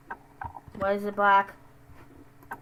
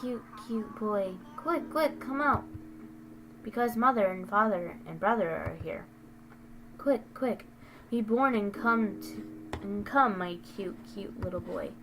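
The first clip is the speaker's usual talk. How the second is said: creepy mother voice; 5 dB quieter than usual. Acted. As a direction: lull the unborn child, sweetly sinister.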